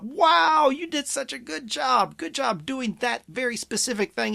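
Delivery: high, gushing congratulation, loud wow at the start; over-the-top praise. Shown as a demonstration. Parent praising a child's good behavior, over the top. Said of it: "and then over the top".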